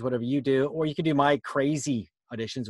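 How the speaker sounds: ordinary talk, nothing marked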